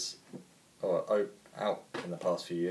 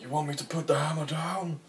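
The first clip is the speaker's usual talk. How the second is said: Thor impression; 7 semitones higher than usual. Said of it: "I love your impressions".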